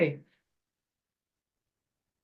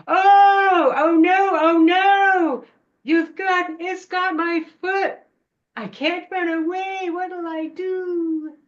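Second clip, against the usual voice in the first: high, loud mock wail; theatrical cry for help, tailing into a drawn-out wail; hammed-up panicked yell; 11 semitones higher, 7 dB louder than usual. Child on the ledge tricking the witch, exaggerated panicked yelling. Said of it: "and I yelled".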